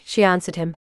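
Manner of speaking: ordinary delivery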